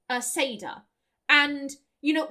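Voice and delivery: ordinary talk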